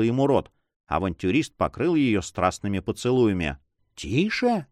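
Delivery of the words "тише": ordinary delivery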